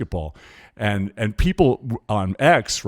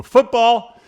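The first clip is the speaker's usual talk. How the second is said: much higher and louder, excited shout; shouted wife's guess; 7 dB louder than usual. Retold or imitated. Wife calls out the sport from the hallway, excited shout. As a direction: blurt the sport name like a game-show answer. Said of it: "she'd walk by and she'd go".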